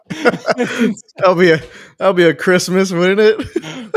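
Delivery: high, loud, laughing tease. Real, not acted. Friend joking about a broken leg at Christmas, laughing. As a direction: squeeze the joke out through big laughter.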